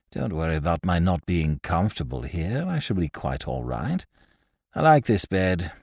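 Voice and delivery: low, soft, languid patient; Pym, smug invalid. Acted.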